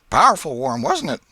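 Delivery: aunt slyly questioning nephew Tom about school; Aunt Polly. A narrator's reading. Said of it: "Said she".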